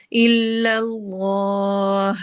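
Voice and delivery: slow chanted tajweed with a long drawn ha; Quranic recitation. Shown as a demonstration. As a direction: recite it, stretching the vowel. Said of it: "جلالہ کو heavy پڑھنا ہے".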